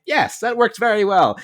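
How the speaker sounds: high, loud, thrilled praise; excited approval. Retold or imitated. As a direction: cheer it like a happy fan. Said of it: "And I'm like".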